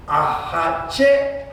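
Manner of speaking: "hache" rising onto an accented last syllable; stress on third syllable. Shown as a demonstration. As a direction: punch and hold the final syllable of "hache". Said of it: "Is it on the third syllable".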